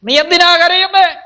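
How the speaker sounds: high, ringing call; preacher crying out God's question; God calling to Moses. Acted. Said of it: "ദൈവം ചോദിക്കുന്നു".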